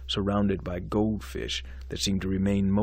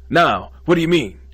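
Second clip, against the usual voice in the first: high, loud sudden alarm; 8 semitones higher, 10 dB louder than usual. Acted. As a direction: jump on his words. Dean alarmed by news.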